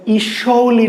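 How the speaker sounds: "ইসৌলি" sounded letter by letter, slowly; wrong split reading. Shown as a demonstration. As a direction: stretch ই away from the rest of "ইসৌলি". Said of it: "কিন্তু উচ্চারণের সময়".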